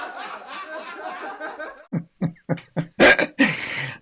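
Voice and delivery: high burst of real laughter; delighted laughing fit; big laugh. Real, not acted. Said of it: "he knows our audience".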